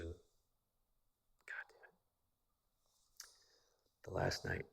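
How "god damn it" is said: slow unvoiced whisper under breath; whispered curse. Real, not acted. Man ashamed of his wife's outfit, whispering. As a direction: breathe it out in dismay. Embarrassed aside.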